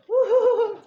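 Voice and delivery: high loud whooping cheer; joyful whoop. Real, not acted. Cheering at her lobster with a loud high whoop. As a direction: whoop with delight.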